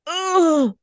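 high pained ugh; disgusted groan. Real, not acted. Disgusted reaction.

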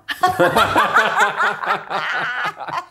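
pleased laugh after showing off; bright laugh. Real, not acted. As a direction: open rising laugh.

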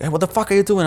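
high, loud angry demand; mock captor yelling; retold shout. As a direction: bark it at the prisoner. Captors shouting at jumping captive.

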